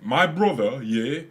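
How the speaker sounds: mock Tyson Fury boast, drawn out; Tyson Fury impression. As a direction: do the boxer boasting about his brother, slow and loud. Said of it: "a video of Tyson Fury saying".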